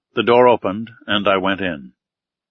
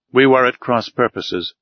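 plain reading voice

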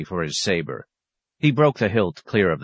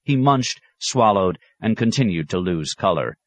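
usual speaking voice

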